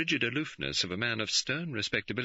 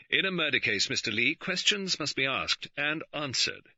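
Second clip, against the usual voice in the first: crisp; 7 semitones higher than usual. A narrator's reading. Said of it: "The Chief Constable said crisply".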